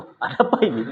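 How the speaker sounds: retold startled "ada apa ini", a little laughing; a first-timer startled by the guards, half laughing. Newcomer startled by the armed guards, mock alarm. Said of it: "Pertama kali ya, kena mental juga".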